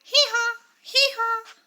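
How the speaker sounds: high loud hee haw; donkey bray. Shown as a demonstration. Teacher answers with the donkey's sound, loud high bray. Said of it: "What is the sound of donkey?".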